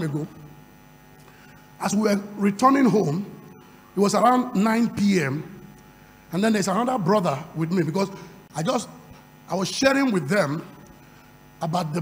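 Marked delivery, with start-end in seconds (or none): none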